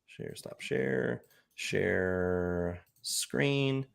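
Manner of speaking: soft, slow muttering aside; muttering to himself. Real, not acted. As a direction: mumble it to yourself. Muttering while sharing screen.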